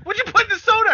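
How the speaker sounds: high, loud demanding voice; accusing shout. Retold or imitated. Mom accusing in retold scene.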